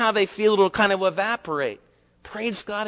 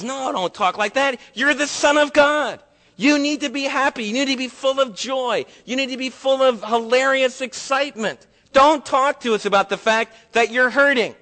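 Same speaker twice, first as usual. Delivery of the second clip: higher, bright scolding cheer; mock cheerful rebuke; 6 semitones higher, 3 dB louder than usual. Preacher mimicking believers scolding Jesus, in higher chirpy cheer. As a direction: parody a pushy believer.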